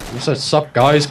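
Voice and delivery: ordinary talk, nothing marked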